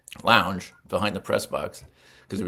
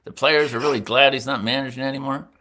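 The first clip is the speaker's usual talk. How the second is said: higher-pitched, lightly imitated side-of-mouth questioning; Killer Kane; 6 semitones higher, 4 dB louder than usual. Traveling secretary quizzing young writer, side-of-mouth imitation. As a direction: talk out of the corner of your mouth, pitched up. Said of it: "he always talked out of the side of his mouth".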